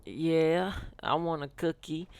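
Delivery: high-pitched imitation of a tearful kid; put-on pitiful whimper; mock crying. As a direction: answer through pretend tears, voice lifted. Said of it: "sitting there crying".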